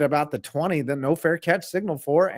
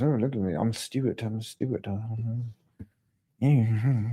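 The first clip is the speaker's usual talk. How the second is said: lower, badly faked British accent; 5 semitones lower, 4 dB quieter than usual. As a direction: ham up a mock British voice. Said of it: "there's so many British accents".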